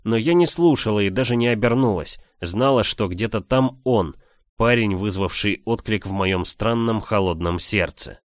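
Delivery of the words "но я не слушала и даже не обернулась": usual speaking voice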